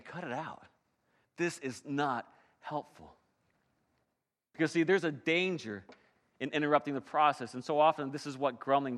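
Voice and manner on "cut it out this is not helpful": quiet rebuke. Acted. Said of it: "And so James is saying".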